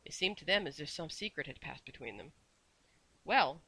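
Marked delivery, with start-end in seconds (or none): 3.2-3.6 loud, brisk opener; bright. A narrator's reading. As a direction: perk up briskly. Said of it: "said Missus Corey brightly".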